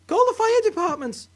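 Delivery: high, loud frantic cry for help; panicked shout. Acted. Wife panics at steaming grandma.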